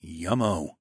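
usual speaking voice

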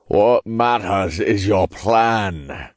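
slow, raspy Scottish voice; drawn-out Scottish rasp; raspy Scottish accent. A narrator's reading. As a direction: put on a rasping Scottish accent. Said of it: "in a raspy Scottish accent".

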